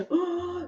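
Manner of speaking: drawn-in gasp of delight; theatrical gasp. Pretend. Host discovers smoked Gouda, theatrical gasp. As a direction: gasp softly as if thrilled.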